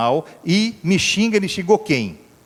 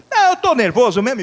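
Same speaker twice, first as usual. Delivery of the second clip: irritated retort; 8 semitones higher, 4 dB louder than usual. Retold or imitated. Angry driver answering.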